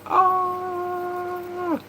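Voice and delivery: mock scream. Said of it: "I'm like".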